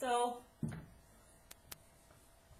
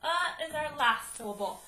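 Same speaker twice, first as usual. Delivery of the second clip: ordinary talk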